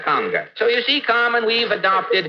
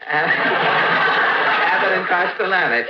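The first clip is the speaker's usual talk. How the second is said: slow, laughing through the names; laughing at the compliment; much slower than usual. Real, not acted. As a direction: chuckle out the names, flattered.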